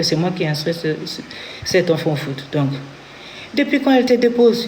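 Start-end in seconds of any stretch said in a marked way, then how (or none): 3.5-4.7 high, sharp repeat of the challenge; retold outburst, pitched high; angry ex-husband. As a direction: mimic him scolding the boy. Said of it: "Il s'est énervé contre le petit".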